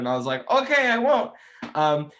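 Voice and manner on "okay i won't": high, loud acted reply; mock-obedient reply, high and loud; retold eager promise. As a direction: blurt the promise, pitched way up. Retells promising owner not to drop guitar.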